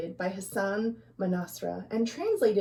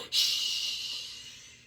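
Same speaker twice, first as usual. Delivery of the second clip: long hushing shh; soft shush; 8 dB quieter than usual. Acted. Old folk hushing laughing children. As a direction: hush them gently. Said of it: "the old folk ... would say".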